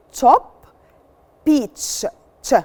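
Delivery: CHOP, PEACH, then CH, each apart with a crisp "tch"; clear CH sound. Shown as a demonstration. Teacher models CH for pupils, clear CH sound. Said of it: "C H is pronounced ch".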